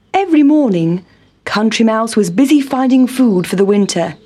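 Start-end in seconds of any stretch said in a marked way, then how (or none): none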